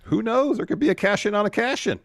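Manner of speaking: high, loud dramatic hype; hyped announcer. Acted. Co-host hyping a double cash-in, high and loud. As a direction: sell the cliffhanger big.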